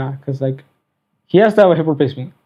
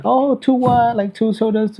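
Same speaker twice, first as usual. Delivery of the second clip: higher-pitched, puzzled question; puzzled questioner; 6 semitones higher, slower than usual. Retold or imitated. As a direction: imitate someone asking for clarification. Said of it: "someone else is like".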